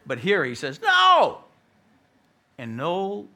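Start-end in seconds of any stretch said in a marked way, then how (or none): 0.8-1.4 high, loud shout of refusal; explosive high cry; shouted denial. Retold or imitated. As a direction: burst out with a flat refusal. Peter's third, emphatic denial acted.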